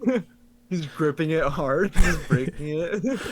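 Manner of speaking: words broken up by laughter; laughing. Real, not acted. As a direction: laugh through the whole line. Laughing while describing video.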